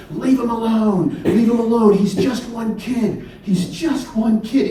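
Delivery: high, slow pleading cry; a boy crying out to the mob; imitated sobbing yell. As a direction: plead through tears, lightly acted. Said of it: "he's crying. I mean, sobbing, and he's yelling".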